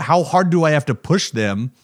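ordinary talk

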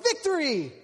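high, loud cheer of victory; triumphant cry. Retold or imitated. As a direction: crow it out in triumph.

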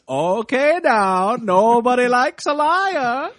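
high, drawn-out Maine sheriff voice; hammed-up small-town lawman with a Maine accent. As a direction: lean into a put-on New England sheriff. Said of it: "this small Maine town sheriff being like".

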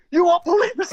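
high, loud, panicky rush; freaking out. Retold or imitated. Singer phoning bandmate Jeff on vacation, panicky and high. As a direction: blurt it out, overwhelmed by the news. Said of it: "Whoa, whoa, calm down".

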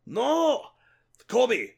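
high desperate cry; anguished yell. Acted. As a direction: cry out in protest. Character outraged at rival.

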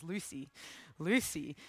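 low, soft call of a name in the night; a voice calling softly in the night. A narrator's reading. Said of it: "this voice calling her".

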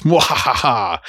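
evil cackle; hammy villain laugh; sneering panto villain. Retold or imitated. Voicing Wanda's villainous summoning laugh.

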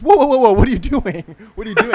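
loud, high panicked whoa; alarmed shout. Retold or imitated. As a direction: yell in alarm at a mistake. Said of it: "And he goes".

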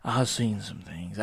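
slow, low knowing drawl; knowing catchphrase. Shown as a demonstration. Podcaster quoting his own worldly catchphrase. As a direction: say it like you've seen it all. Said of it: "I usually say that".

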